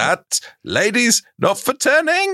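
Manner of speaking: high-pitched political speech impression; mock Prime Minister, pitched right up; Margaret Thatcher. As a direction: deliver it as a punchline in a very high stateswoman's voice. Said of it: "a good impression of Thatcher".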